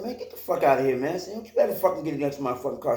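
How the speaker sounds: furious rapid-fire threat; angry warning. Retold or imitated. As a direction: drive him off with a hard, quick warning. Threatening the man off.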